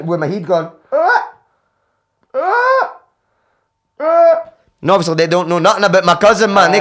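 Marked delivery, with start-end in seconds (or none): 0.9-4.4 high, loud drawn-out wails; anguished animal wails. Retold or imitated. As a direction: howl like a wounded animal. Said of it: "thought there was a wild animal".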